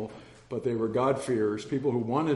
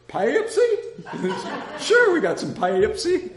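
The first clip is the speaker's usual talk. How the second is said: laughing; 13 semitones higher, 7 dB louder, slower than usual. Retold or imitated. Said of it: "and he goes".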